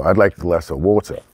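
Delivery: "water" in a British accent, ending "wah-tuh" with no R. Shown as a demonstration. Showing how a British person orders water, British accent, no R. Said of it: "was what a British would say".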